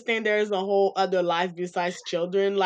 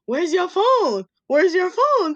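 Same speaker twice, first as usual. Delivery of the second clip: put-on higher, louder taunting child voice; teasing little sister; 8 semitones higher, 5 dB louder than usual. Little sister taunting her big sibling, high playful voice. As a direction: mimic a little kid taunting.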